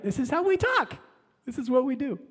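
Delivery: This is how we talk said high and teasing; mocking emphasis. Shown as a demonstration. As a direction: throw it up high in a mock lilt.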